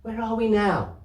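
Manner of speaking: put-on high child's question. Child asking the storeman on the long ride, high and eager. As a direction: ask like a tired little girl.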